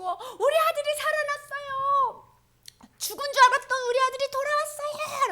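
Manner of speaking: father telling neighbors his son returned, overjoyed; jubilant announcement. Acted. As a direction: sing out the good news.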